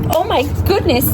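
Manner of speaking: sudden high startled cry; high-pitched real alarm; startled gasp. Real, not acted. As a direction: caught off guard by something darting past. Startled by a lizard.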